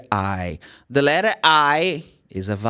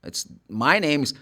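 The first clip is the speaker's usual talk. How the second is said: ordinary delivery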